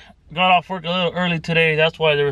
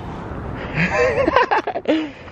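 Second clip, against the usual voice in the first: high laugh; 13 semitones higher than usual. Real, not acted. Laughs at George.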